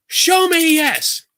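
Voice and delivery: very high, stretched-out mock demand; slow, high, frustrated imitation; exasperated dowser's command. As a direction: imitate her straining to command the rods. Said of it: "She's like".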